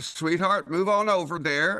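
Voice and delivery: a Southern driver sweetly scolding another car; Southern accent, honey-coated jab at a bad driver; Southern drawl at traffic. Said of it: "this will show how Southern I am".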